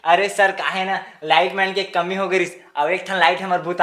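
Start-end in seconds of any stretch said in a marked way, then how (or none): none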